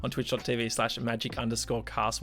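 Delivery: loud, upbeat show host plug; announcer read. Acted. Host announcing the show times to viewers, in a brisk announcer voice.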